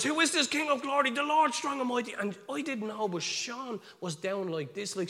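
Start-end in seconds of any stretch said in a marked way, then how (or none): 0.0-2.1 high, loud urgent recitation; proclaimed psalm. Retold or imitated. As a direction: shout the psalm over the horn. Acted loud psalm prayer.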